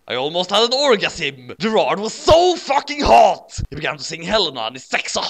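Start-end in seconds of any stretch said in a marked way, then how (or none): 1.6-3.6 high, loud, drawn-out gushing; gushing fangirl. A narrator's reading. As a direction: swoon loudly over the singer, stretching it. Fangirl gushing over singer.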